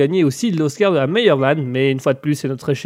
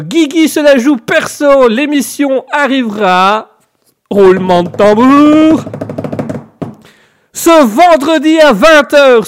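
loud, high, drawn-out show announcement; hyped announcer; 7 semitones higher, 6 dB louder than usual. Pretend. Host announcing his show after a drum roll, loud hyped announcer voice. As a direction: stretch and punch it like a big reveal.

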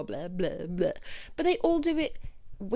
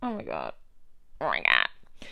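exasperated groan. Real, not acted. Exasperated by moaning colleagues.

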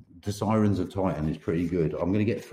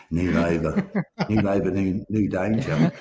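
laughing; 8 semitones higher than usual. Real, not acted. Mocking a political slogan, laughing.

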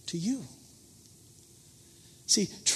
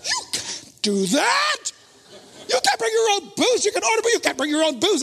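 mock outrage; 12 semitones higher than usual. Inner voice scolding the drinking seatmate, mock indignant shout. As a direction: blurt out the indignation.

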